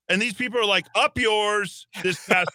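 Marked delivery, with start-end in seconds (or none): 1.0-1.9 high-pitched, acted-out crowd defiance; mock defiance. Host voicing Amsterdam protesters answering the gathering limit, mock defiance. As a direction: throw it out like a cheeky protester.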